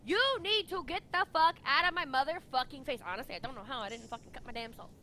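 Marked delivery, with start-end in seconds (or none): 0.0-3.0 loud furious snap; angry yell. Retold or imitated. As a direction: yell at him to back off. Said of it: "I turn towards him and I'm just like".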